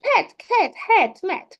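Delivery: put-on high, bright demo voice for a child; teacher demonstrating to a student, high playful voice. Shown as a demonstration. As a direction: play the teacher setting a sound task, pitched well up. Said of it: "And say".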